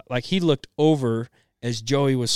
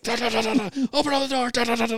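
mock rage; 11 semitones higher, much faster than usual. Imitating dad's rage.

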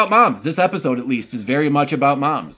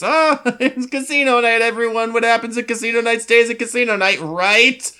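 nervous party host; 8 semitones higher than usual. Retold or imitated. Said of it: "Kirsten tries to cover up".

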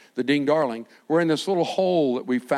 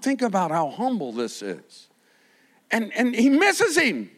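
ordinary talk, nothing marked

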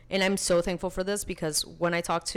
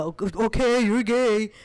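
louder, higher sarcastic imitation; mocking disbelief; 4 semitones higher, 6 dB louder than usual. Bar flirt doubting a rejection, loud mocking disbelief. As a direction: mimic a skeptic who isn't buying it. Mimics skeptical men at bar.

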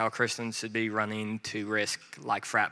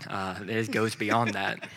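ordinary delivery

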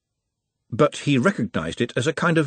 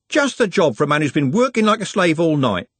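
higher-pitched, jaunty lad; Trev, cheeky worker; 6 semitones higher, 4 dB louder than usual. A narrator's reading. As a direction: play the hard-worked charmer.

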